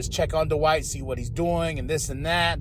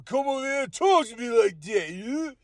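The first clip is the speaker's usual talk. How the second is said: high, hollered tough-guy imitation; hammed-up movie tough guy calling someone out; Stallone; 8 semitones higher than usual. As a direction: play a confrontational star, pitched way up. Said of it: "such great acting on Stallone".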